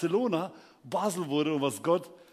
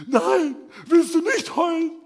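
high, loud tearful protest; mock crying; 11 semitones higher, 8 dB louder, slower than usual. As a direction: wail the question accusingly, hammed-up. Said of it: "die läuft so weiter und weint". (gespielt heulender Vorwurf)

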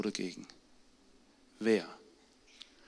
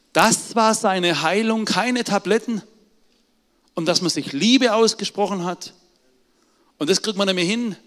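laut und höher, mit Nachdruck gepredigt; hämmere es der Gemeinde ein; eindringliche Predigt; 8 semitones higher, 8 dB louder than usual. Acted. Predigt mit voller Kraft. (laute, eindringliche Predigt)

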